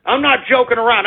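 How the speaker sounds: ordinary delivery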